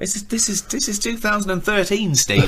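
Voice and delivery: patronising mock explanation that slows to a laughing end; mock lecturing. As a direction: talk down to Steve, grinning. Mocking Steve about the future.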